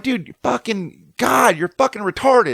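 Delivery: slow, high, exasperated insult; pissed. Retold or imitated. Surfer splashing water at the intruder, pissed. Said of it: "like, I'm pissed".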